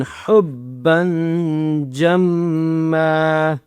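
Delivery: حُبًّا جَمًّا with held nasal hum on both words; ghunnah. Shown as a demonstration. Teacher showing ghunnah, حُبًّا جَمًّا slow and nasalised. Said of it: "دونوں پر غنہ بھی کرنا ہے".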